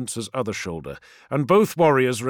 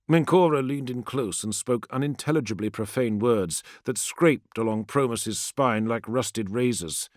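normal talking voice

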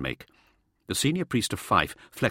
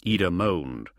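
usual speaking voice

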